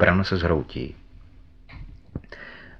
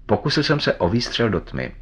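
plain reading voice

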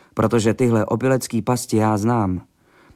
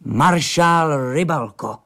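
slow, emphatic, higher-pitched proclamation; fired-off announcement; 4 semitones higher, much slower than usual. A narrator's reading. Drunk Fábera springs the name on stepfather, emphatically. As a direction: declare the name triumphantly, stretching each word. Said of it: "vypálil na něj Fábera a praštil pěstí do stolu".

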